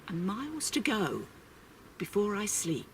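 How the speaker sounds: the echo drops to a hush. A narrator's reading. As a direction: let the last line fade away softly. Repeated closing line of poem.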